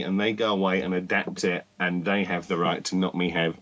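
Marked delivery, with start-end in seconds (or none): none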